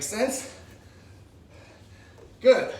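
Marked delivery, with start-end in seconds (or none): 2.4-2.7 loud coach shout. Real, not acted. Coach cheering class on.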